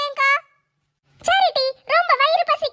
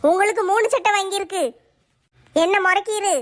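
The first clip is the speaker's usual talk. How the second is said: wife throwing the three shirts at him; sulky wife counting gifts; wife's voice, lower; 6 semitones lower than usual. Acted. Wife reminding husband of his three shirts, nagging. Wife repeats her point.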